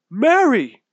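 loud, high call of her name; father crying out as his lost child returns; relieved cry. Acted. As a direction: farmer calling out to his daughter. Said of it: "the farmer cried".

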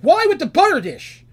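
high, loud outraged cry; exasperated yell. Acted. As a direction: shout it, fed up with the butter again. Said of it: "And he's like".

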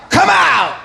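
high, forceful order; sharp acted command; commanding Jesus. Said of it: "And he's like".

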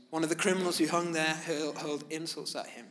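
ordinary talk

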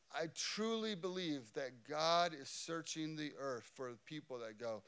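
ordinary talk

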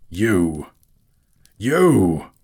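loud outburst, building; enraged cry. A narrator's reading. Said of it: "cried Thorin".

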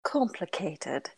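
breathy whispered complicated. Real, not acted. Tired girl whispering her answer to a friend, whispered. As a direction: whisper it, drained. Whispered word then narration.

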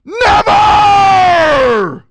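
long, drawn-out high shout of "never"; emphatic shout. Shown as a demonstration. Preacher hammering home the Greek lesson, emphatic shout. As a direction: bellow "never" at full force, stretching it out. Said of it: "It is the strongest way you could use it".

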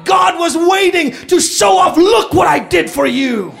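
loud, high, driving sermon delivery; preaching at full force. Acted. Pastor rousing the church, loud full-force preaching. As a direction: pound the message home to the congregation.

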